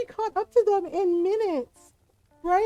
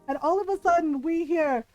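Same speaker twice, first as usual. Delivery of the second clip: usual speaking voice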